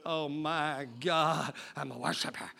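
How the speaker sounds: a hint of a hushed fake worshiper; mock pious murmur. Preacher imitates a falsely devout churchgoer for the congregation, low and hushed.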